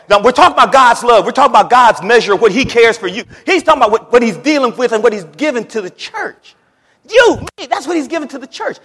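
high, loud, insistent proclamation; full-force preaching. Real, not acted. Preacher declaring God's love to the church, high and loud. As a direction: hammer each phrase out to the crowd.

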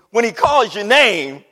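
loud, emphatic repeat; forceful shout falling on the last word; preacher's outburst. Real, not acted. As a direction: belt it out to the congregation.

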